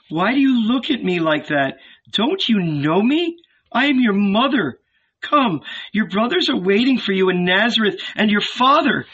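high, anxious, imploring voice; hurt, high-pitched entreaty; pleading mother. A narrator's reading. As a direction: beg your son to know you. Mother pleads with estranged son.

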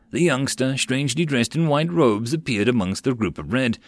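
ordinary delivery, nothing marked

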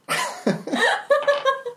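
squeaky hiccuping laugh; very high character giggle. Acted. Calvin giggles.

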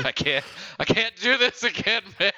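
laughing. Real, not acted. Laughing through tears.

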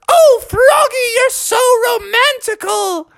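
put-on very high, swooning girl voice; swoon, very high and sweet; high swooning Beatrice. A narrator's reading. Beatrice swooning at Froggy's announcement, very high.

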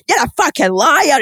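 hammed-up accusation; mock angry shout. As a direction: jab it like a reality-show row. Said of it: "different accents shouting angrily".

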